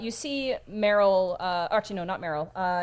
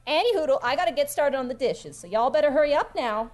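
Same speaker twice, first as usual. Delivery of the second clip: chatty tavern hand shooing guests along; bustling, motherly character voice; folksy Skinny; 5 semitones higher, 5 dB louder than usual. Acted. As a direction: hurry them up with homely cheer. Plays Skinny hurrying guests along.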